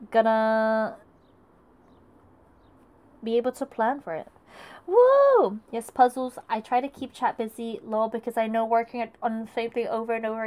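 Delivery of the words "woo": high, loud woo; cheering. Real, not acted. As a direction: whoop with excitement. Celebration cheer.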